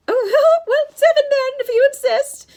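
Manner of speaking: loud, very high, coy falsetto. A narrator's reading. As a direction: concede sweetly in a false lady's voice. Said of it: "Grover said in his falsetto voice".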